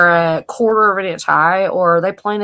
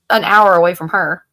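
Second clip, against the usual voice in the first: ordinary delivery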